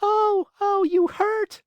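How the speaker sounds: very high, loud little cry of pain; tiny voice crying out. Acted. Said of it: "cried the same faraway little voice".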